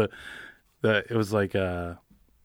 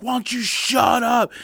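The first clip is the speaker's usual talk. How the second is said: mock angry snap; 14 semitones higher than usual. Flyers fan telling a heckler to shut up, mock angry. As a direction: square up to the heckler. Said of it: "Philly Flyers fans is like".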